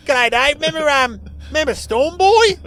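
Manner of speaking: high, loud, excitable talkback caller; caller impression. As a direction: pitch it up like an over-keen radio caller. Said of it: "he sounded like one of your code".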